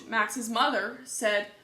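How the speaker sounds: ordinary delivery, nothing marked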